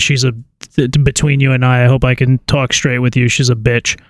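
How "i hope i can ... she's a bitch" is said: ordinary talk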